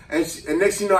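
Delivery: usual speaking voice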